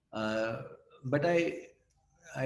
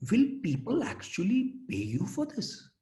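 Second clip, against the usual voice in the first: a skeptical mother, voice raised; doubtful mother; 10 semitones higher than usual. Retold or imitated. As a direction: question it like a doubting parent. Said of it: "the second question she asked".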